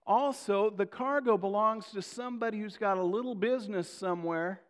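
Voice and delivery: loud, high preaching voice pushed hard; full-force preaching. Acted. Pastor driving the congregation through the storm story, loud preaching. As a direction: preach it out to the whole room.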